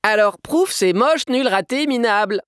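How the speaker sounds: put-on high-pitched merry little girl; bright-spirited, high child reciting; cheerful child. A narrator's reading. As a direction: recite the insults gleefully in a squeaky kid voice. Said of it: "reformula Sophia, toujours gaiement".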